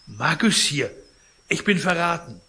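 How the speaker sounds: alarmed cry. Acted. Sick man sees dealer at door.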